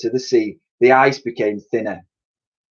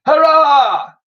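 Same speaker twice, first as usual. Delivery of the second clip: much higher, louder hurrah; called out cheer; 6 dB louder than usual. A narrator's reading. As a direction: cheer it like a happy mammoth. Woolly cheers with the crowd.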